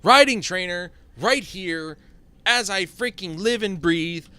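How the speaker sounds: loud, high exclamation of disbelief; excited discovery. Real, not acted. Player finally spotting a riding trainer, excited. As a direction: call it out high and loud, amazed.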